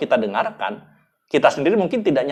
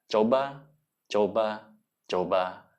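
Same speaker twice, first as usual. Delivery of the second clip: coba coba coba much softer, each one sinking lower and fading; soft urging; 3 semitones lower, 7 dB quieter, slower than usual. Shown as a demonstration. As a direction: murmur each coba gently fading away. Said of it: "ingin mengajak orang untuk menangis".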